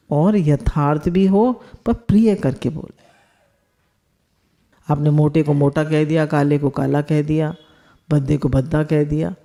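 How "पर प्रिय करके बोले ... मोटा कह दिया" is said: normal talking voice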